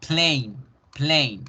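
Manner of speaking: plane with a clear ey glide; teacher drilling plane; English plane. Shown as a demonstration. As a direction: repeat plane for the class. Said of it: "como en avión".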